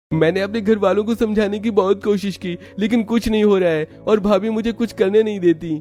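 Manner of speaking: put-on high boyish voice, frustrated; young brother-in-law. Yash tells Preeti his family won't listen, high boyish voice. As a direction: complain like a helpless young boy.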